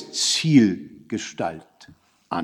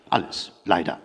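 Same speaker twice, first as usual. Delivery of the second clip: hushed regret; 7 semitones lower, 7 dB quieter than usual. A narrator's reading. Regretful confirmation to the victim.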